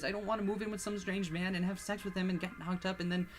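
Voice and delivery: high, loud, panicked wailing; wailing refusal. Acted. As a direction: sob the refusal over and over.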